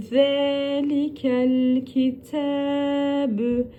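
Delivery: Zelikel kitebü drawn out slowly; slow recitation. Shown as a demonstration. Teacher reciting the phrase slowly. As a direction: chant it slowly, vowels stretched. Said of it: "T'yi ne yaptık".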